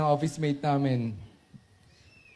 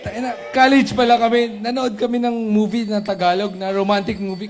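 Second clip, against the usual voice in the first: laughing; 6 semitones higher, 5 dB louder than usual. Real, not acted. Laughing through college story.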